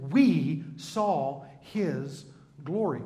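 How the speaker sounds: "We saw his glory" drawn out slowly, each word weighted; solemn, deliberate quote; slow emphasis. Shown as a demonstration. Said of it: "he specifically says".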